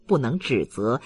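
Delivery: plain reading voice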